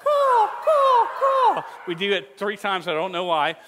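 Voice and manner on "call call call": very high, loud cawing crow voice. Shown as a demonstration. Youth pastor leading kids in a cawing crow call. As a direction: caw the word call like a crow. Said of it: "wanted to sound like a crow".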